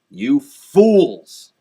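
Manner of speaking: loud, exasperated outburst; angry insult. Real, not acted. Conservative berating global warming believers, loud and scornful. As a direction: hurl it at your opponents. Insults opponents in political rant.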